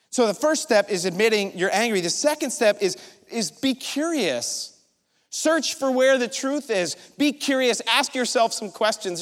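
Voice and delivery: ordinary talk, nothing marked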